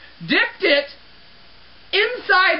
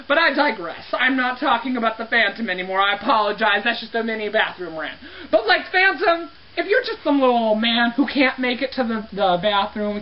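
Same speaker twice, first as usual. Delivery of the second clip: ordinary talk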